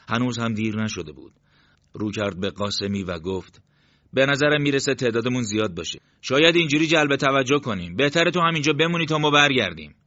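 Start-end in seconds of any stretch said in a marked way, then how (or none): 4.1-9.9 raised, higher, louder dialogue voice; louder, higher voice than the narration; squad leader addressing his men. A narrator's reading. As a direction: speak up as a leader talking a man into staying behind.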